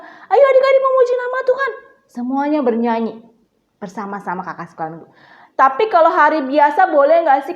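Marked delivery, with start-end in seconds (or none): 0.0-1.7 put-on high-pitched rallying call to children; Sunday school teacher calling. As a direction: imitate a teacher urging the kids to join in. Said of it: "Kakak sekolah minggu bilang".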